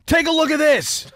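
high, loud put-on complaint; mock indignant customer. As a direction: play a cowboy storming in to complain. Acted cowboy complaining at counter.

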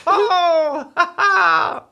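ordinary talk, nothing marked

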